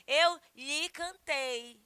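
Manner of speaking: slow, deliberate correction, "Eu" stressed; corrected form. Shown as a demonstration. Teacher to students, fixing the sentence, slow and stressed. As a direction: spell out "Eu lhe cantei" slowly, leaning on "Eu". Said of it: "Lhe é um pronome oblíquo?".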